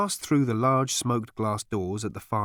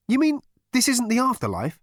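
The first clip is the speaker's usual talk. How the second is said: high, louder incredulous question; astonished; 14 semitones higher, 5 dB louder than usual. Acted. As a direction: ask in disbelief, voice shooting up. Arthur, astonished, in story.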